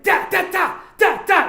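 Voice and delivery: high, loud percussive bursts; mock fight sound effects; imitated punch noises. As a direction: spit out punch sounds like rapid blows. Imitates punches being thrown.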